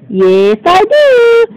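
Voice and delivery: high, slow squeaky insistence; insistent fishy. Pretend. Magic fish insisting to doggy, high and slow. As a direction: stretch it out high like a stubborn little fish.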